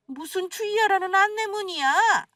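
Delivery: indignant interrogation, pitch jumping high at peaks; scolding mother-in-law. Acted. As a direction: scold them for lying to you. (거짓말을 꾸짖는 시어머니)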